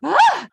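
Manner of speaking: high indignant "ah!" cry; mock outraged exclamation; dramatic huff. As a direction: storm out with an offended yelp. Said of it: "she gets out of the car so dramatically".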